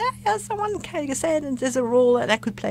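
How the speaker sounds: high, breathless rush of good news; squeaky high excited report to the family; excited retelling. Retold or imitated. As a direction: gush it out like a thrilled kid coming home. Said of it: "So I was so excited".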